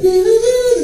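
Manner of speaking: very high, loud beeping notes; imitated phone tone. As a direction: beep like the out-of-service tone.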